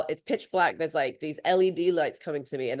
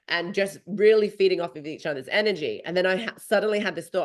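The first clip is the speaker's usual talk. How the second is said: usual speaking voice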